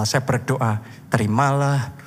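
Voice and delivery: low, solemn prayer; deep-pitched benediction; pastoral blessing. Real, not acted. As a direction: pronounce it over the congregation, phrase by phrase. Prays a blessing over congregation.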